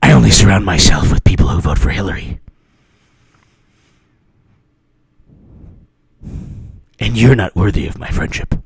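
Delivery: low, mock-haughty put-down; haughty dismissal. Snubbing a non-Hillary voter, low mock disdain. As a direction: dismiss them in a low, cool voice. Acted snub of former friend.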